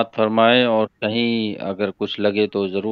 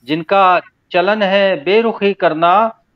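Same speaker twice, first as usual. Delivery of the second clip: emphatic unsung ghazal recital; spoken verse recitation; 7 semitones higher, 3 dB louder than usual. Acted. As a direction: declaim the couplet with rising, lingering phrase ends. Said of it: "मैं तहत में ही पढ़ता हूँ".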